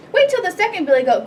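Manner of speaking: high, quick little goat voice; tiny billy goat piping up urgently; small goat voice. A narrator's reading. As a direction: bargain fast in a small high voice. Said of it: "his very small voice".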